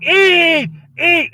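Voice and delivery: very high, loud, drawn-out scream; screaming little girl. Acted. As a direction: shriek the order like a child in a tantrum. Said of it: "she starts screaming".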